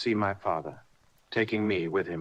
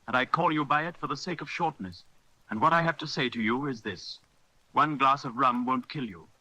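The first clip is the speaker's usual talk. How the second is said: the doctor's voice, higher and stern, scolding; 6 semitones higher than usual. Acted. Doctor warning the drunken captain about rum, stern and higher. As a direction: lecture him like a sharp physician.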